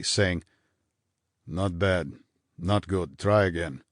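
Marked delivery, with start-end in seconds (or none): none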